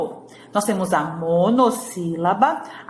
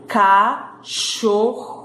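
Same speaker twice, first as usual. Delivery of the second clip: ca-chor-ro, slow with gaps between syllables; much slower than usual. Shown as a demonstration. Teacher sounds out Cachorro for pupils, slowly by syllables. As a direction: split Cachorro into its beats. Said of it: "três vezes também".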